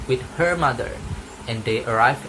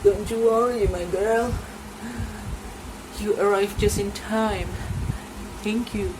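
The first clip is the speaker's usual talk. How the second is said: higher, soothing granny voice; grateful grandmother; 9 semitones higher than usual. Acted. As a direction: comfort the girl, thank the hunter.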